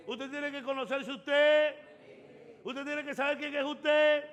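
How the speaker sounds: loud, high, building preacher cry; preaching at full force. Acted. Pastor exhorting the church, loud and high. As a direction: drive the point home to the congregation.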